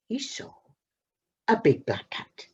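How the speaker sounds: plain reading voice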